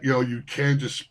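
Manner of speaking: normal talking voice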